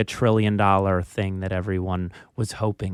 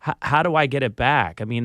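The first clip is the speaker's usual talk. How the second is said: ordinary talk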